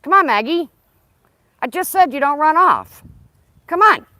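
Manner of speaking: real urgent call to the dog; loud calling. Real, not acted. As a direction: shout her back before she bolts.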